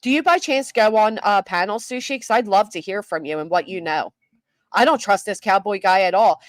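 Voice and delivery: ordinary talk, nothing marked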